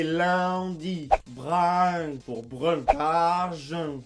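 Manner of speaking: lundi et brun étirés en « a-un », lentement; nasale diphtonguée. Shown as a demonstration. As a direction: glisser de A vers UN dans brun. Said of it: "le un est devenu A un". (« lundi », « brun » à la québécoise)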